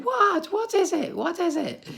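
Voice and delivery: very high, eager asking mum voice; curious mum. Retold or imitated. Mum begging the kids to tell her the joke, very high eager asking. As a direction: mum desperate to be let in on the joke. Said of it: "Mum would be saying".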